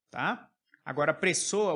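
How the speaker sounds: ordinary talk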